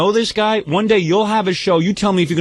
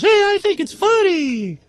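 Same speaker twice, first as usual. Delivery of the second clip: high, slow mock Jackie voice; slower than usual. Howard mimicking Jackie shrugging off the prank, high slow mock voice. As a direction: drag it out high like a clueless Jackie. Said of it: "And then Jackie goes".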